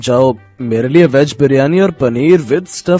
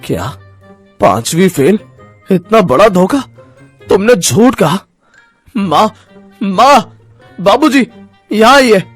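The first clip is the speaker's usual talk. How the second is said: outraged husband, pitch climbing as he calls out; angry shouting. Acted. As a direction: erupt in disbelief, then call your parents. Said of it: "रजत गुस्से में आ जाता है और जोर जोर से चिल्लाने लगता है". (गुस्से में चिल्लाते हुए)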